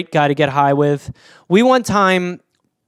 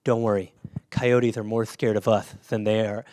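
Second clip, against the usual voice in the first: low, soft lisping imitation; hushed, lisped mock reassurance; reassuring friend; 8 semitones lower, 8 dB quieter than usual. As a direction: mimic a laid-back friend with a lisp. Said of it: "He goes".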